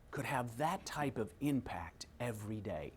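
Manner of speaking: soft, breathy, hushed wonder; hushed reflective whisper; whispered question. Real, not acted. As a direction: whisper it as a thought.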